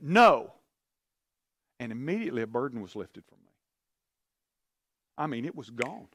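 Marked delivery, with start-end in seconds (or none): 0.0-0.5 loud, sudden commanding shout; much louder, forceful divine shout. Retold or imitated. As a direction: bark it out like a voice from above. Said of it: "it was just like that".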